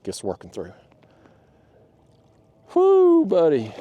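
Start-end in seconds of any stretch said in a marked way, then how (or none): 2.7-3.7 loud, high whoo; splashed by a fish, loud excited whoop. Real, not acted. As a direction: whoop as the water hits.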